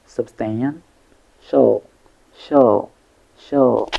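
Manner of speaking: "Substantial" broken up, then "shall" alone and repeated; syllable by syllable. Shown as a demonstration. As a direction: separate the last syllable "shall" with clear gaps. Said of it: "three syllables".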